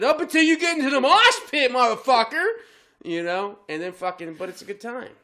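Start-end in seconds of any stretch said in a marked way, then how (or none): 0.0-2.5 higher, louder, laughing retort; hammed-up shout; taunting yell. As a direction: bark it back as a joke. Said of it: "I said".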